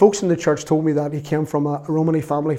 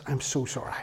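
hushed, lower retold apology; remorseful apology; 4 semitones lower, 13 dB quieter than usual. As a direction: say it very softly, as if abashed. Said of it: "Mick looked round at everybody and went".

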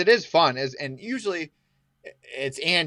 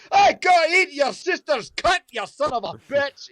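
high, loud shouted insults at a friend; high comic shouting; mock road rage yell; 13 semitones higher, 6 dB louder than usual. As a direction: yell across the traffic light for fun. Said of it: "who are like".

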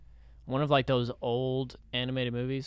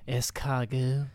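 mock French; slower than usual. Mock French word for snails.

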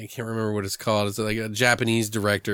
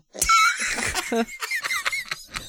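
high shrieking laugh. Real, not acted. Laughing hard at his own clerk impression, high shrieking laugh.

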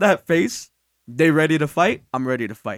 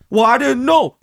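higher, louder acted excuse; raised-voice retold excuse; mock defensive protest; 4 semitones higher, 5 dB louder than usual. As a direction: play a flustered dad defending himself. Retold dad defending himself.

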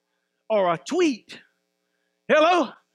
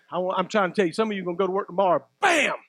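usual speaking voice

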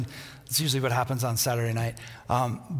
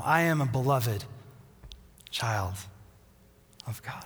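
fading to a whisper; 3 semitones lower than usual. Acted. Final answer, fading out.

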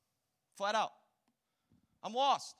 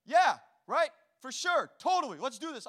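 eager yes; 5 semitones higher, 9 dB louder than usual. Retold or imitated. Kid eagerly agreeing.

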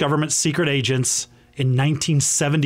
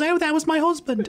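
much higher, put-on quoted voice; retold wife's reply. Wife answering praise of her husband, retold in a high voice.

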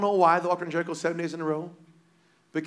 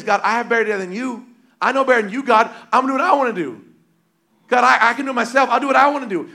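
loud, higher, proud self-will against God; stubborn defiance; 6 semitones higher, 9 dB louder, faster than usual. Retold or imitated. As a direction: boast like you know better than God. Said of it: "we're saying is".

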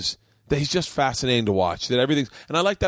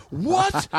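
high-pitched incredulous "what"; retold disbelief, pitch leaping way up; astonished. Podcaster reliving his reaction to a wild story, astonished.

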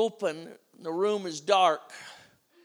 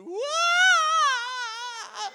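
high wailing cry like a siren; 4 dB louder than usual. Retold or imitated. Frightened girl running from the bear, high wail. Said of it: "she turns on the siren".

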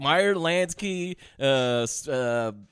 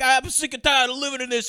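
higher, hammed-up Brooklyn accent; mock Brooklyn accent; 7 semitones higher than usual. Podcaster mimicking a bad gangster movie for friends, mock Brooklyn accent. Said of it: "he's doing the Brooklyn accent".

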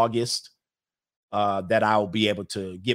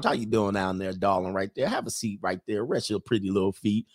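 Southern charm. Pretend. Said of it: "turn on that little Southern charm".

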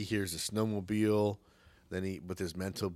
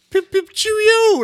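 shrieky high, loud, slow English toff parody; way over-the-top English "cheerio"; joke English accent; 16 dB louder than usual. Acted. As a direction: ham up a ridiculous English accent. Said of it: "like a joke English accent".

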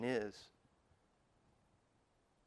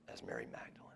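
whispered; 14 semitones lower, 17 dB quieter, faster than usual. Real, not acted. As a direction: drop to a whisper on the name. Hushed sermon reflection.

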